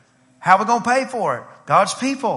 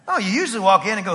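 usual speaking voice

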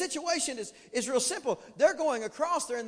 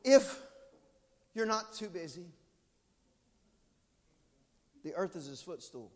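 timid hesitant prayer; 9 dB louder, slower than usual. Retold or imitated. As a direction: pray like you barely dare to ask. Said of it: "You go to God".

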